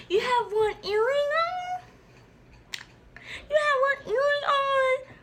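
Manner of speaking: high, slow, whiny mock voice of the classmate; mocking shocked girl. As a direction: squeal it like a mock-offended girl.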